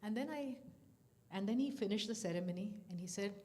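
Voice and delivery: normal talking voice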